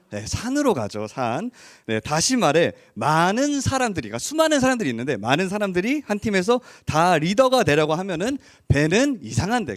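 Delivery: ordinary talk, nothing marked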